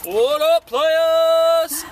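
high, loud victory whoop; excited cheer. Real, not acted. Fan crowing over the Cardinals' World Series trip, excited cheer. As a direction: shout it like your team just won.

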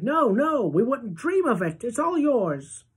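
high, loud chorus of refusal; the family refusing Charlie's offer, loud and high; cried out. A narrator's reading. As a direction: insist warmly, all at once. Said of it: "all the others cried".

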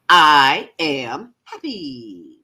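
joyful big affirmation; like you won the lottery. Shown as a demonstration. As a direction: proclaim it with total conviction. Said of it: "say it like you mean it, like you just won the lottery".

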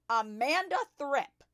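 loud, high drawn-out shout. A narrator's reading. Headmistress summons a pupil across the playground, shouting. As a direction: bellow the name slowly across the yard. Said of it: "she was shouting".

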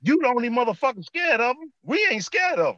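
high-pitched defiant outburst, retold; retold shout-out. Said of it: "I shouted out".

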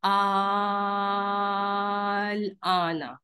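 vowel in आल stretched five counts, very long and slow; five-count madd. Shown as a demonstration. Teacher shows the five-count stretch to the class, very drawn out. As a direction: hold it for the full five counts. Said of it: "अलिफ के बराबर खींचेंगे".

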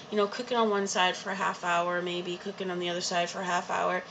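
ordinary talk, nothing marked

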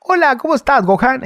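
very high, odd cartoon voice; cartoon dub voice. Greeting Gohan as a Dragon Ball character, high put-on voice. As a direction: greet in a high-pitched anime parody. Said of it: "Mario de Kame".

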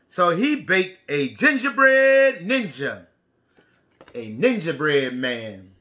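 storybook read-aloud for the class; lively read-aloud for children. A narrator's reading. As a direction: tell it like a picture book to little kids. Reads picture book aloud.

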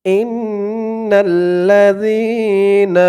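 slow, melodic tajweed recitation; slow Quranic chanting. Shown as a demonstration. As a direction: chant each word long and drawn out. Teacher recites Quran slowly.

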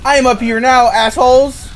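high, loud gloating call; loud taunt. Real, not acted. Player announcing arrival to opponents, loud taunting shout. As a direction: crow it at the opponents.

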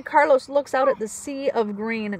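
plain reading voice

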